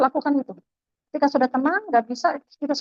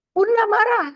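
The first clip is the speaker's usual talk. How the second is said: mock angry mother; 9 semitones higher than usual. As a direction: announce the mother is angry, pitched up. Mother announcing her anger.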